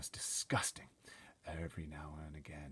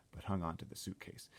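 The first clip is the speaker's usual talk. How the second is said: ordinary delivery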